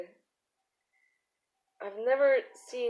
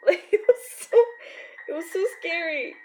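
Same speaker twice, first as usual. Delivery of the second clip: laughing; 6 semitones higher, 3 dB louder, faster than usual. Real, not acted. Laughing while telling wasp story.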